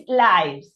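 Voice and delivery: "lives" said as the plural of life, with a long "eye" vowel; corrected pronunciation. Shown as a demonstration. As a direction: say "lives" to rhyme with "hives", drawn out. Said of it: "The pronunciation of".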